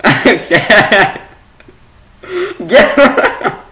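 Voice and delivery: loud, high, laughing through the words. Real, not acted. Laughing at the cat behind the webcam. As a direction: shoo it off while cracking up.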